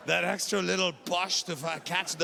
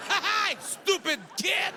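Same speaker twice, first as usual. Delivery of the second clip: much higher, slow gloating jeer; mocking taunt; 10 semitones higher, slower than usual. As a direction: taunt the kid like a schoolyard bully. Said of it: "I'm like".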